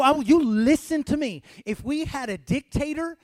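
loud, high, driving preacher's cry; fiery preaching. Acted. Pastor exhorts the church to rejoice before the King. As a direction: build the fire and keep it burning.

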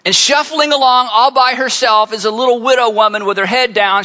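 ordinary delivery

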